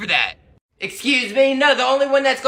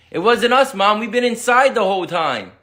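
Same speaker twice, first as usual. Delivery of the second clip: ordinary talk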